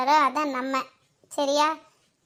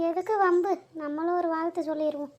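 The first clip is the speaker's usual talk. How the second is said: much softer conspiratorial aside; quiet plotting aside to the daughter-in-law; hushed aside; 6 dB quieter than usual. Acted. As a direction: keep it under your breath. Aside so the father-in-law won't hear.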